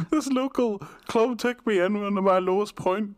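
high-pitched mock interviewee, hammed-up; mock guest voice. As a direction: squeak it out like a gushing guest. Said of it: "Watch your words".

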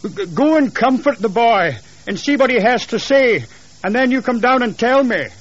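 high-pitched urgent instructions; stammered start, then nervous calling upstairs; agitated husband. Acted. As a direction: send her off, pitched high and insistent.

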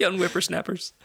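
put-on high, grumbling old-timer; lightly voiced old prospector. Old-timer grumbling about kids.

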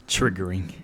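low, soft warning announcement; content warning. Pretend. Co-host warns listeners before the college rant, low warning voice. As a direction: drop low like a solemn disclaimer. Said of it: "I'm gonna give the warning".

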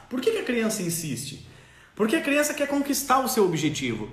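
normal talking voice

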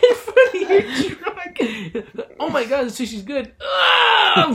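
high, loud laughing then a mock cry; mock sick customer. Joking about sushi truck customers, laughing mock wail.

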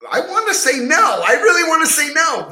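loud, high-pitched protest; emphatic reluctance. Real, not acted. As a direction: cry it out, really wanting it to be so. Torn answer, pleading emphatically.

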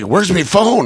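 high-pitched, rough attempt at Irish; bad Irish accent. Mark asking after his phone, in a poor Irish accent. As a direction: imitate an Irishman, none too well. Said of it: "He's Irish, and I'm not doing a very good accent".

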